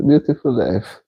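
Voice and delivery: ordinary delivery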